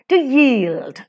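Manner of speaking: "to yield" with YIELD stressed; light "to", strong "yield"; iambic stress. Shown as a demonstration. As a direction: lean on "yield" in "to yield". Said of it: "unstressed and stressed".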